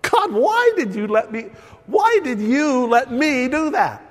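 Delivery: pleading with God. Retold or imitated. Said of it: "Saying things like".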